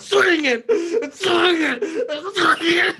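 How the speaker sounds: slow, high, crying shout; teen confessing the killing, sobbing shout. Acted. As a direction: break down on each swinging.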